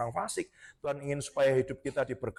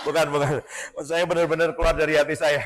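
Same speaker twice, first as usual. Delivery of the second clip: real laughing denial, louder; sambil tertawa; 2 semitones lower, 7 dB louder than usual. Real, not acted. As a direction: talk through genuine laughter. Tertawa menyangkal soal tema. (menyangkal sambil tertawa)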